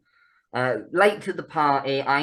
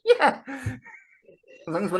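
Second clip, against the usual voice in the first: talking through laughter; amused laughter; 10 semitones higher, slower than usual. Real, not acted. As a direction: laugh as you play along with the pun. Laughing at co-host's pun.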